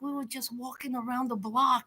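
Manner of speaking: soft, low, unbothered explanation; hushed imitation of a calm, reassuring guy; soothing homeboy. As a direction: explain it gently, nearly murmured. Voices homeboy soothing mama.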